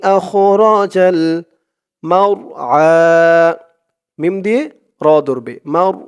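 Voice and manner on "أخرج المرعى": slow, careful Quran chant; slow recitation. Shown as a demonstration. Teacher reciting the next verse slowly. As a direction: recite each phrase slowly. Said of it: "আপনারাও আমার সাথে তেমনভাবে বলবেন".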